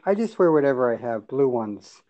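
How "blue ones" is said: ordinary delivery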